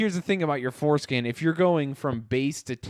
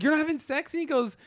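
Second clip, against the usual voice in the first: higher, naive pleading tone; high mock voices; 12 semitones higher than usual. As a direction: play both voices high and innocent. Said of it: "And I was like".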